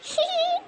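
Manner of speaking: high sneaky giggle; gleeful giggle. Acted. Daughter-in-law giggles gleefully at her mother-in-law's scolding. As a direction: snicker with glee at her trouble.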